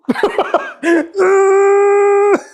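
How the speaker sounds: high, loud burst of laughter. Real, not acted. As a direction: let a big laugh explode out. Laughing while pushing Jenny.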